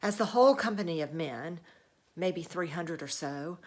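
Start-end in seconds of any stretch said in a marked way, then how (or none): none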